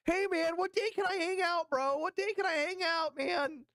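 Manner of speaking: high, needy buddy voice; eager Elon. Retold or imitated. Mocking Elon pestering Epstein, high needy voice. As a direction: pester him like an eager kid. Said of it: "Elon's just the entire time".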